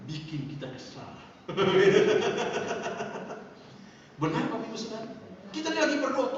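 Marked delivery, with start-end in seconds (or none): 1.2-3.9 long, loud laugh; drawn-out amused laughter; real laugh. Real, not acted. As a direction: laugh heartily at your own joke. Laughs at his own joke.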